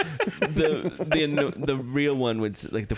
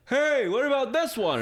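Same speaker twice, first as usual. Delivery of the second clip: much higher, teasing call; playful call; 14 semitones higher than usual. Pretend. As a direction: toss it out playfully. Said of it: "I'm like".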